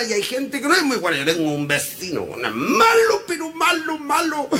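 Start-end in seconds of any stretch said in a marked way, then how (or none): 2.2-4.5 MALO drawn out and pitched high, repeated; emphatic. Acted. Comedian describing his bad neighbour, long high stress on MALO. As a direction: hit "malo" higher and stretch it out.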